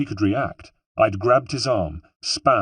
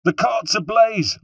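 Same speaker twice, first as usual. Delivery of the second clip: put-on far higher, slow, loud cry in a Corsican accent; Corsican rebel shout; 13 semitones higher, 5 dB louder than usual. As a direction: repeat the alarm louder as a Corsican rebel. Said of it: "I repeated".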